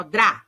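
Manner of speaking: dra with D and R each audible; both consonants sounded. Shown as a demonstration. Teacher isolating a cluster for pupils, articulating both consonants. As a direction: sound the D then the tapped R in dra. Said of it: "eu sinto o D e o R".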